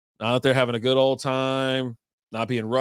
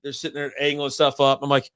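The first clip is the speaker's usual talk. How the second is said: ordinary talk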